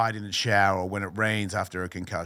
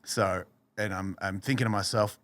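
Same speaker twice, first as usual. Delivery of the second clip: normal talking voice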